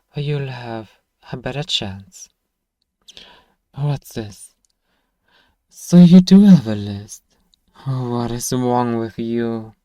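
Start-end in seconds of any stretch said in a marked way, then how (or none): 5.7-7.2 sudden loud, high exclaim of discovery; caught-out surprise. Acted. Shopkeeper catches the goblin hiding a list, surprised. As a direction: pounce on it, louder and higher.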